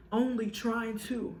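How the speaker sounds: ordinary delivery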